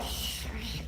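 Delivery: whispered shh shh; mock whisper; imitated shushing. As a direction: hush them like a gossip. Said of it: "She was talking like that".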